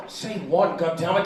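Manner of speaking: ordinary talk, nothing marked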